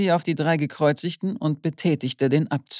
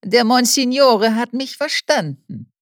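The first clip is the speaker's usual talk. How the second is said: high, loud and spiteful; sneering triumph; gloating; 7 semitones higher, 4 dB louder than usual. A narrator's reading. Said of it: "sagte Höhnfeld hämisch".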